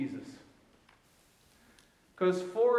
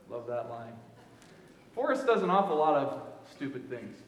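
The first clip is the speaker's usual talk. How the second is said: ordinary delivery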